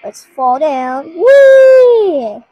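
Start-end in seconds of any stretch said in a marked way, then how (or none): 1.1-2.4 high, loud falling whee; gleeful whee. Real, not acted. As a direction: cheer as you drop down. Falling down in game.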